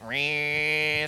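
long, sustained horn buzz; imitated vuvuzela drone. Preacher mimics World Cup horn noise, droning.

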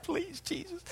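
very high, very soft squeaky plea; desperate prayer. Retold or imitated. Praying for the ring to fit, tiny high plea. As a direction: beg heaven in a tiny voice. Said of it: "I'm just like".

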